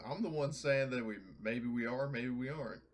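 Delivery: ordinary delivery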